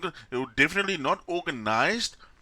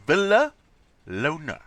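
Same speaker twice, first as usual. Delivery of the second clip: Villalona very slowly, split as Villa, lona; drawn-out syllables; 5 dB louder, much slower than usual. Shown as a demonstration. Puzzling over a very unique surname, Villalona drawn out slowly. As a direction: stretch out Villalona with a break before lona. Savouring an unusual surname in pieces.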